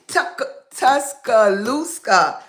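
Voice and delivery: Tuscaloosa slowly, with an extra k, "tus-ka-LOOS-ka"; botched pronunciation. Real, not acted. Guest struggles with the town's name, slow botched attempt. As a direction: stumble into Tuscaloosa, drawn out as "Tuskalooska". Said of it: "Am I close?".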